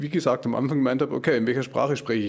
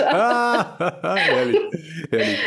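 laughing out the word, high and loud; real delighted laugh through the words; amused delight; 7 semitones higher, 6 dB louder, much slower than usual. Real, not acted. Laughing reaction.

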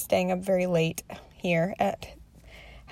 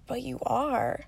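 lightly voiced small child, soft and earnest; a hint of a young girl reassuring her mom; serious little daughter; 3 semitones higher, 7 dB quieter than usual. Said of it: "she looked at me so seriously".

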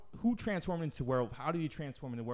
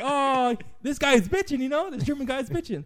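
much higher and louder, gushing; excited kid; 12 semitones higher, 9 dB louder than usual. Retold or imitated. The fat kid praising the German neighbor, in a high excited squeal. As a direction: squeal it with delight. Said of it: "And he's like".

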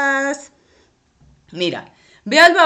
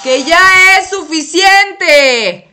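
very slow, loud and high shout; shouting over the blaring dryer; full shout; 7 semitones higher, 9 dB louder, much slower than usual. Acted. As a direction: yell so he finally hears. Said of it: "pero no me grites".

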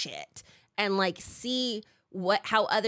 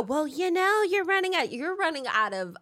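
high-pitched mock know-it-all; nagging busybody; 8 semitones higher than usual. Mimicking someone who nags her about her age, nagging. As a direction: put on a higher, fussy voice. Imitates nagging urgent care remark.

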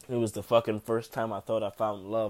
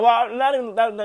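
flustered; 13 semitones higher, 13 dB louder than usual. Real, not acted. Correcting himself mid-sentence.

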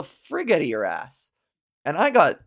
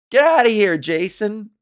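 loud, higher mock scolding; shooing away; 6 semitones higher, 8 dB louder than usual. Host shooing Jason out, loud mock scold. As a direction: chase him off playfully.

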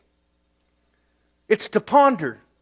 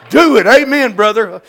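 mock cheer; 3 semitones higher, 10 dB louder than usual. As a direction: hype him up like a preacher. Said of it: "I didn't talk like that then".

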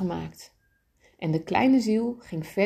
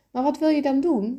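a touch of nerves in a higher voice; a bit nervous; 8 semitones higher, 4 dB louder than usual. A narrator's reading. Little soul asking what bad deed is coming, slightly nervous. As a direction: ask it a little uneasily. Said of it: "een beetje nerveus".

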